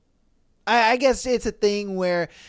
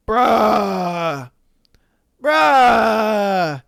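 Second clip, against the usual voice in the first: louder drawn-out groaning bruh; exasperated bruh; 2 semitones higher, 7 dB louder than usual. Real, not acted. Host reacting to wife's "nothing is wrong", loud exasperation. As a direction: groan at the audience in disbelief.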